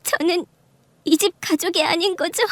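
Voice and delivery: high, weepy voice at normal volume; tearful. Acted. Mistress crying before the wife's family, tearful. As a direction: plead through acted tears. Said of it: "상간녀가 얼굴을 가리고 울기 시작하는".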